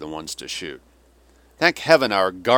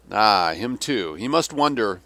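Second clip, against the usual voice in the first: ordinary delivery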